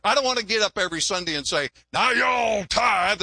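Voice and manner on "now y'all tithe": drawn-out mock shout; hammering demand. Pastor hammering the congregation on giving, slow mock shout. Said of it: "I don't like hammering on giving".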